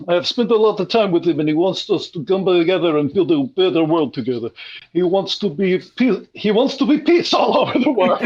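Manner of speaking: put-on Israeli accent, enthusing; Israeli scientist. Scientist urging Cameron to meet Carpathia, mock Israeli accent.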